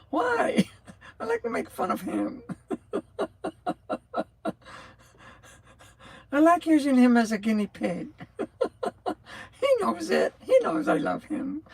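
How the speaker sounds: high, laughing between words; giggling tease. Real, not acted. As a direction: giggle through the teasing about Brian. Laughing, teasing about Brian.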